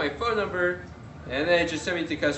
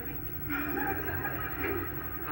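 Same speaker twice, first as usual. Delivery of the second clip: silent laugh; 8 dB quieter than usual. Real, not acted. Laughing at the photo moment.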